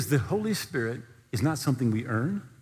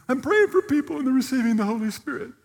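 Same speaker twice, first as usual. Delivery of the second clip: crying elder; 9 semitones higher than usual. Retold or imitated. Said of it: "And he's crying".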